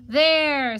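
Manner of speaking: loud, high exclamation of completion; satisfied fox. Acted. Fox finishes bathing the piglet, satisfied. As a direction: announce it loudly, job done.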